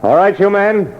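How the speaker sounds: lieutenant shouting at hidden hijackers; calling out. Acted. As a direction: order them out from a distance. Lieutenant calls men out of alley.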